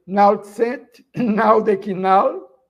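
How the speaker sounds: slow, drawn-out Esperanto numbers; teacher spelling out each syllable slowly; slow syllable demo. Shown as a demonstration. Teacher modelling numbers slowly for learners. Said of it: "Depois vem o mil".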